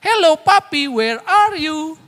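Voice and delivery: suara anak tinggi memanggil papinya; suara anak kecil. Retold or imitated. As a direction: tirukan anak kecil dengan suara jauh lebih tinggi. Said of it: "dia akan terus". (anak dua tahun memanggil papinya saat meeting, suara anak tinggi)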